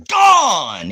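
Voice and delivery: loud, high burst on gone; triumphant shout. Real, not acted. Host kicking a viewer out, triumphant shout. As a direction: fling it out like a ban hammer.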